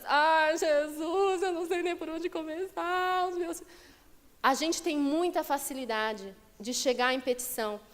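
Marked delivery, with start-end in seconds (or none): 0.0-3.6 higher, sighing mock plea to Jesus; higher, drained mock prayer; overwhelmed pleading prayer. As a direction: play a frazzled parent praying. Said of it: "Aí, B, crianças".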